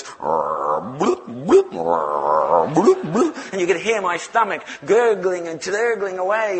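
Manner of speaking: loud rolling gurgles with bloops; imitated stomach gurgle. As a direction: imitate a rumbling belly over loudspeakers. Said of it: "you could hear this".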